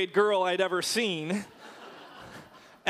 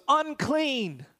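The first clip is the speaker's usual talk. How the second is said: mock shout; 6 semitones higher, much slower than usual. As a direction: call it out as an outcast's warning, restrained. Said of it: "all that they could shout".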